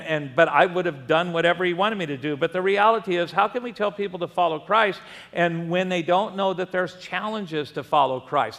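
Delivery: ordinary talk, nothing marked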